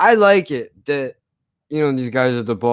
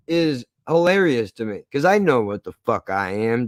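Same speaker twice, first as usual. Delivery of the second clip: ordinary talk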